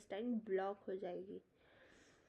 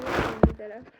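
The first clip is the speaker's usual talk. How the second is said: पल्ली तरफ barked out much louder in rough western UP style; harsh UP dialect; 14 dB louder than usual. Shown as a demonstration. Teacher mimics harsh western UP speech, much louder. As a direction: bark पल्ली तरफ like a Meerut local. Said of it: "इतना harsh वो लोग बोल रहे थे".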